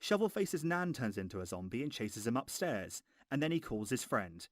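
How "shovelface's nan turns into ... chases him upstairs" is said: ordinary talk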